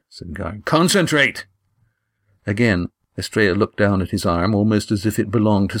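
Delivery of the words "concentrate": higher, urgent order; urgent coaching command; sharp command. Acted. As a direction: snap him back to focus. Gar urging Estrella sharply.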